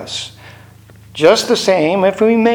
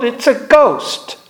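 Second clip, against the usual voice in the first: slower, drawn-out echo of the fearful cry; retold frightened yell; 6 semitones higher, slower than usual. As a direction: stretch it out, spooked. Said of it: "they yelled".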